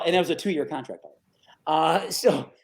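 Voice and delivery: normal talking voice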